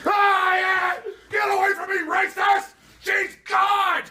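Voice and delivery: a mocked protester shrieking in falsetto frenzy; mock screaming protester. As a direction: scream it like a hysterical mob, way up high. Mocking screaming crowd.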